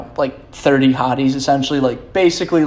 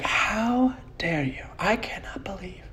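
mock scandalized whisper; 7 semitones higher, 11 dB quieter than usual. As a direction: whisper the scolding like an appalled stepmom. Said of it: "she's like".

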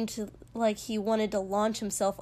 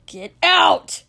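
very loud, high roar; explosive, raging shout; furious bellow; 8 semitones higher, 14 dB louder than usual. A narrator's reading. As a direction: bellow it with full fury. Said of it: "Katsuki bellowed furiously".

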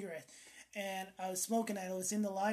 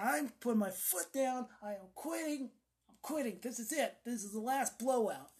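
higher, emphatic self-declaration; firm resolve; 4 semitones higher than usual. Retold or imitated. Smoker putting foot down about quitting, resolute. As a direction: lay down the law to yourself. Acted firm vow to quit.

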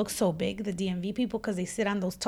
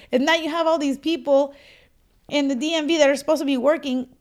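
ordinary delivery

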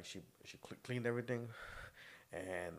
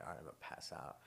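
whispering; 6 semitones lower, 10 dB quieter than usual. Retold or imitated. Sleepy quote before passing out.

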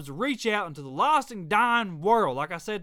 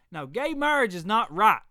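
normal talking voice

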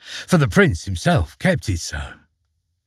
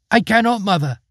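higher, calling down from above; a light call from a window; calling girl; 9 semitones higher, faster than usual. Acted. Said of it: "cried Gold Tree from on high".

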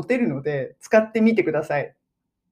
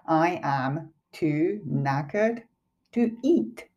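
very slow, separated words for learners; 6 dB quieter, much slower than usual. Shown as a demonstration. Teacher breaking down the example for viewers, slow and careful. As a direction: spell out the sentence one word at a time.

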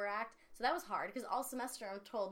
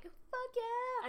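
triumphant cheer. Retold or imitated. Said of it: "And I'm like".

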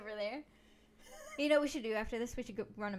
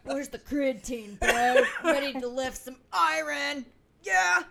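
very loud jock bellow; hammed-up weight-room hype; pumped-up gym bro; 12 dB louder, slower than usual. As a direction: shout it like a meathead lifter. Playing aggressively athletic gym bro.

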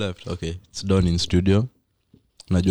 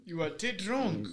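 high, slow mock threat; drawn-out villain retort; slow mock menace; 10 semitones higher, 8 dB quieter than usual.

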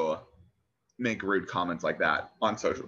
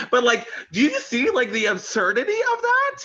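much higher, laughing as she talks; laughing disbelief; 4 dB louder than usual. Real, not acted. To her co-host about the commenter, high laughing disbelief. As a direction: laugh through the words, incredulous.

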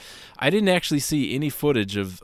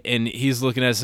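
usual speaking voice

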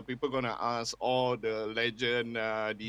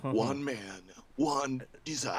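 movie trailer voice; 3 dB quieter, slower than usual. Acted. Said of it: "that's movie trailer voice already".

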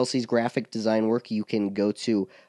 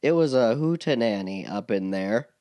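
ordinary talk, nothing marked

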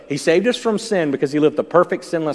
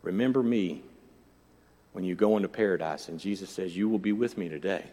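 hushed, gentle, much softer; soft quoted words; 7 semitones lower, 9 dB quieter than usual. Acted. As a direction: say it tenderly and quietly. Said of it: "the criminal who was on the side of Jesus said".

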